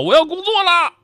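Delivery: high mock shout of resolve. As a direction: belt it out like a pep call. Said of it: "大喊".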